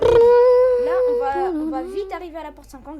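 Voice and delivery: fast, loud and hyped; rushing the team on, pumped up; excited. Real, not acted. Excited rush to door fifty.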